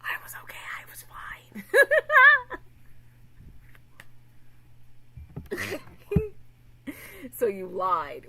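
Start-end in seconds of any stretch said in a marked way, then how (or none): 0.0-1.6 hushed impression. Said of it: "He's like". 1.7-7.3 loud shrieking laugh; high burst of laughter. Real, not acted. Laughing at story.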